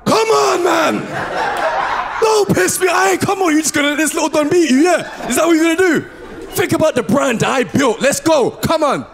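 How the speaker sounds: hammed-up sports-day dad bellowing; yelling dad. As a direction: scream the toddler on like a desperate coach. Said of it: "I'm just like".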